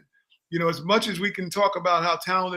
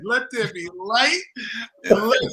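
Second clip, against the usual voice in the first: mock prophet; 4 semitones higher, 4 dB louder than usual. Mock biblical quote of prediction.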